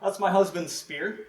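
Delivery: casual wife. Retold or imitated. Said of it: "And she's like".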